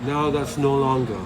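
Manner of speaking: ordinary talk, nothing marked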